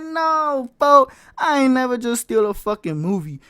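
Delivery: loud, high-pitched angry rant; mad outburst. Retold or imitated. Storyteller reliving finding out he stole a movie, mad yelling. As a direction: yell it in outraged disbelief, voice up high. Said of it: "I was mad".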